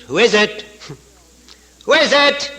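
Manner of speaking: high parrot squawk of a question; talking parrot. Acted. Parrot repeating its only phrase, high-pitched parrot voice. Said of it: "all it would say is".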